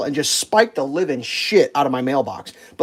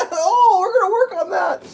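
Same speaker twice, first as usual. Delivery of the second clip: very high, put-on brush-off; mock promise. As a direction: mimic the workers in a silly high voice. Mocking workers' empty promise.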